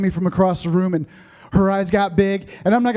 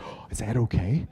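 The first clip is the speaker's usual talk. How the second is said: hushed doubt; 8 semitones lower, 7 dB quieter than usual. Retold or imitated. Unsure aside, feeling good at work.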